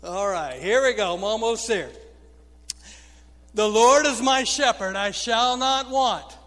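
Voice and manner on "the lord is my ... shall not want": loud, sustained psalm reading; loud scripture proclamation. Acted. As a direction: proclaim each verse to a responding congregation. Recites psalm for congregational response.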